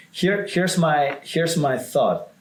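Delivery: ordinary talk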